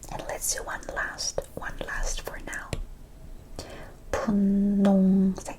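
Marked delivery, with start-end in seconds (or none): 4.1-5.6 Pun nong sek said slowly and louder; slow demo. Shown as a demonstration. Teacher slowly modelling Pun nong sek. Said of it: "omitting to read this".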